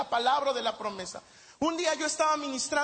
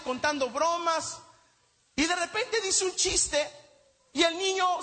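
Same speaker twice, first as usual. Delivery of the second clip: normal talking voice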